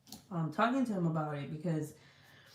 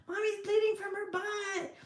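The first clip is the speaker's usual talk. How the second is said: put-on panicked little boy; 14 semitones higher than usual. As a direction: cry it out like a scared kid. Son voiced in story.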